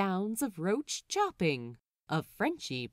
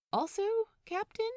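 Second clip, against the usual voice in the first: unsure; 13 semitones higher, faster than usual. A narrator's reading. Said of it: "things are very unclear lately".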